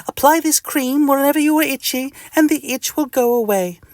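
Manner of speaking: very high lady doctor voice; high and matter-of-fact; Missus Doctor. Acted. Doctor reassures the itchy patient, high put-on voice. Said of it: "says Missus Doctor".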